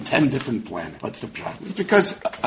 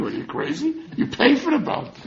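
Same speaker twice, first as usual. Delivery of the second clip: laughing. Acted. Mock scolding, with laughter.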